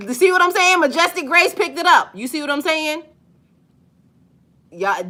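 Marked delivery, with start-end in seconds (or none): 0.1-3.0 higher, louder, triumphant; excited exclaiming. Real, not acted. Streamer thrilled a viewer picked up her point, loud and high. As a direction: gloat that the chat caught it.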